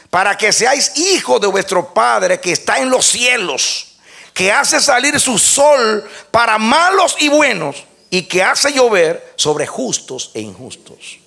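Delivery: high, loud preaching proclamation; full-force preaching. Acted. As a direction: proclaim it to the congregation at the top of your voice. Preaching the verse. (predicación a todo pulmón, alta y fuerte)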